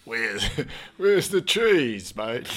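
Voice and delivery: hammed-up Australian shout for the cheese; Australian accent. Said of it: "that's Australia, mate".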